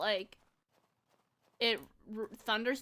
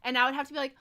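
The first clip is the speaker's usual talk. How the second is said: normal talking voice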